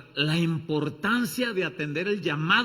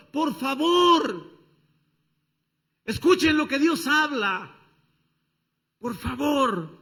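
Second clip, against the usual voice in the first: high, loud, slow begging; pleading cry of Wisdom; 9 semitones higher, 6 dB louder, slower than usual. Acted. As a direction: beg the people to listen. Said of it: "la sabiduría que grita".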